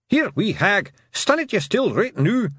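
high, loud Scots little man; tiny blue man. A narrator's reading. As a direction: shout it in a high, urgent Scots voice.

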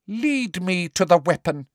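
high, eager prim witch; prim teacher pressing a pupil eagerly; Umbridge voice. A narrator's reading.

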